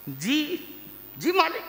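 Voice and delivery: imitating an eager, toadying underling; fawning flattery. Underling sucking up to the boss, mock fawning. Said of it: "ये चापलूसी में आता है".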